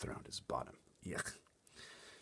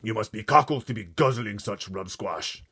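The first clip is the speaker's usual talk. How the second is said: booming giant; 4 semitones higher, 9 dB louder than usual. Acted. Blood Bottler holding up the Snoscumber, booming. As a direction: boom it in disgust.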